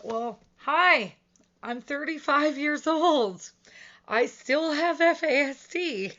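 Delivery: loud, high, emphatic self-declaration; loud, incredulous "hello, right here" rebuttal; exasperated mock announcement. Real, not acted. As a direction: call it out pointedly, high and loud, to bust a myth. Mock rebuttal to the adults myth.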